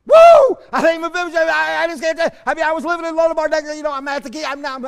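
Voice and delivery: high, loud, fast overjoyed outburst; whooping, giddy joy; excited testimony. Pretend. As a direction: gush like a man who can't believe it. Mephibosheth bursting out at testimony time.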